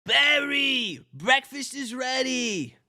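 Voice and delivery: high motherly call, slow; hammed-up mom voice, high and slow; mom calling Barry. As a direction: call him down to breakfast. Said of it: "Janet Benson".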